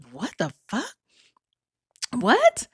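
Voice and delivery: disbelieving what, squeaking up high at the end; baffled. Retold or imitated. Retelling her reaction to the boyfriend, baffled disbelief. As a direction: play her own stunned confusion. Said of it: "And I'd be like".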